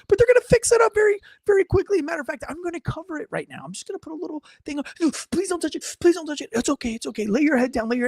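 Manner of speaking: put-on gently calm a scared kid down; reassuring.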